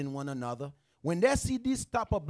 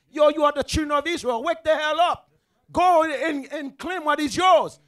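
loud, fast, pressing appeal; a brother urging him loudly in the street; urgent street plea; 6 semitones higher, 9 dB louder than usual. Retold or imitated. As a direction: shout it at him like you're waking him up. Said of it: "Then they look at him like".

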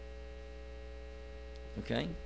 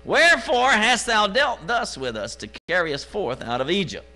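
complaining Israelites; 7 semitones higher, 5 dB louder than usual. Acted. Preacher voices complaining Israelites.

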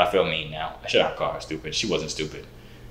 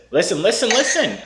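higher, rising repeated calls; urgent calling; 10 semitones higher than usual. Real, not acted. Host calling the girls to listen, higher each time. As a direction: grab their attention.

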